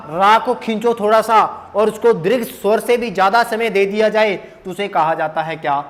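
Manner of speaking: ordinary talk, nothing marked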